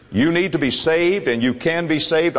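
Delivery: ordinary delivery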